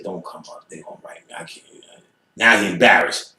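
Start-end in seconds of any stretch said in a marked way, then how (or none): 0.0-1.6 very soft, hushed pleading; scared quiet plea. Retold or imitated. Ellis's lady pleading with him, very soft and hushed. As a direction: hush it, almost whispering.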